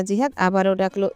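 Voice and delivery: plain reading voice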